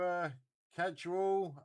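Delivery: ordinary talk, nothing marked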